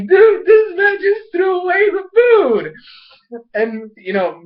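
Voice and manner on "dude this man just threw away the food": high, loud, slow exclamation; mock outraged disbelief. Viewer reacting to Milo tossing the food, mock outrage. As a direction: drag it out in hammed-up shock. Acts own shocked reaction.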